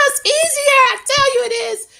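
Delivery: high, squealing laughter through the words; laughing, high-pitched insistence. Real, not acted. Laughs while insisting on advice.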